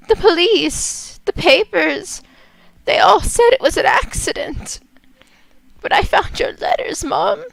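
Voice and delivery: put-on high, hushed, pleading young woman; a grieving daughter begging her dead mother for the truth; Jane, pleading daughter. Daughter Jane begs the psychic's spirit mother, high and pleading.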